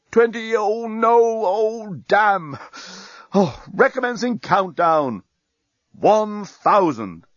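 high, slow, drawn-out dismay; moaning bomb. A narrator's reading. Bomb giving up and restarting its count, moaning slowly. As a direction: groan slowly through a ruined countdown. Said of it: "moaned the bomb".

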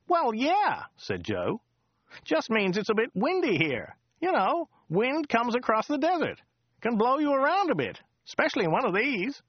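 high, chatty local driver voice; chatty driver Joe. Acted. Driver Joe chatting to Dirk on the drive, high chatty voice. Joe's voice.

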